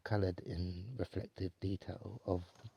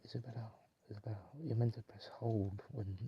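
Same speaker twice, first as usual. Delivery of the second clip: ordinary talk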